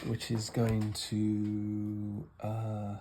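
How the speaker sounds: slow, absorbed, trailing off; slowing right down, lost in concentration. Real, not acted. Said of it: "Did you notice then my voice slowed up".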